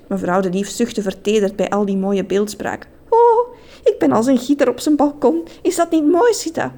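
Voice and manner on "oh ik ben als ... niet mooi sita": high, gushing, swooning voice; flattered lady. A narrator's reading. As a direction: gush like a flattered sweetheart.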